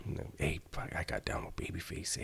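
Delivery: a hushed, voiceless confession, barely audible; whispered admission. Retold or imitated. Beaten-up guy admitting he lost to Babyface, whispered. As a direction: whisper it like you're ashamed to be heard.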